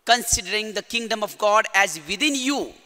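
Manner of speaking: normal talking voice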